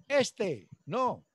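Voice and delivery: high, terse "Este" as the sergeant taps a shoulder; sergeant picking recruits. Retold or imitated. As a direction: pick him out like a drill sergeant. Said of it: "el sargento decía".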